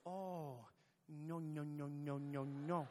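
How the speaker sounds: low-voiced old Spaniard; low, repeated refusal from an old Spaniard. Old Spaniard correcting the tourist next to him, calmly dismissive. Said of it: "was this, was this old Spaniard".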